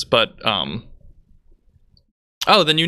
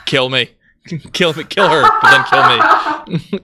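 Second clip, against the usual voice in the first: louder laughing cringe plea; laughing mock despair; 4 semitones higher, 7 dB louder than usual. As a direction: groan it out through laughter. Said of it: "And it's just like".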